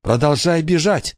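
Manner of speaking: high, tense urging to herself; urgent self-urging. Acted. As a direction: push yourself on while running. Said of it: "твердила она себе".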